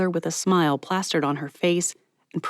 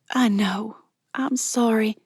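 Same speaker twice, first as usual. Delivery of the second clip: whispered apology; 5 semitones higher than usual. Acted. Mallory hugging Miss Patty, whispered apology. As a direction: murmur it into the hug.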